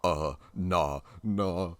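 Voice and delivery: loud, drawn-out refusal; nope. Retold or imitated. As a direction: hell no, backing away. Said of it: "I was just like".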